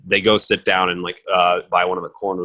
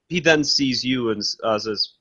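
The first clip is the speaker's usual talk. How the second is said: ordinary talk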